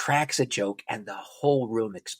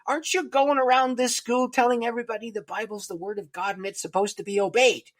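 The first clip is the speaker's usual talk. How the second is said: scolding; 12 semitones higher, 4 dB louder than usual. Retold or imitated. Classmate scolds him like a mother.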